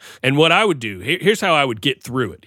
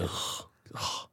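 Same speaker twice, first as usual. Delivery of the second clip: disgusted groan; 17 dB quieter than usual. Real, not acted. Dreading the talking dog.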